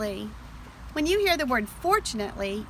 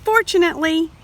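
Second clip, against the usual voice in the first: higher, louder fortunately; relieved cheer; 6 semitones higher, 5 dB louder than usual. A narrator's reading. Teacher reading to children, bright relief. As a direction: sing out the relief.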